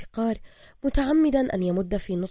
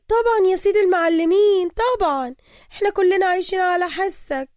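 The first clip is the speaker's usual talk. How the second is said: higher nervous flattery; stammering; 11 semitones higher, 8 dB louder than usual. Acted. Mahasen appeasing the thug, stammering. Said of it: "ردت محاسن بتلعثم".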